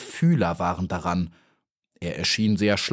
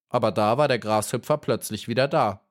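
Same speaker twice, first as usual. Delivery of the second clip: ordinary delivery, nothing marked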